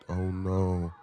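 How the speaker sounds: usual speaking voice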